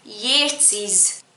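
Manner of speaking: "Yateses" with an extra syllable after Yates; slow, mocking "Yateses" with a double S ending; doubled ending. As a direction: draw out "Yateses" slowly, stressing the surplus ending. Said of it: "it has an S on it already".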